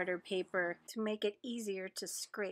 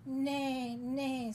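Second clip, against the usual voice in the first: horse's neigh; 6 semitones higher than usual. Acted. Horse greeting the busy spider, voiced neigh. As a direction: call it out like a storybook horse. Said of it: "said the horse".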